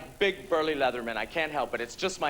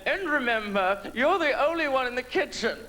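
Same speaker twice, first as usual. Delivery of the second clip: put-on high, affected British-sounding accent; Julia Child; 6 semitones higher than usual. Cooking host tells the camera, high affected British accent. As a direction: imitate a TV cook's put-on British lilt, pitched up. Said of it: "people take on British accents just so they'll sound better".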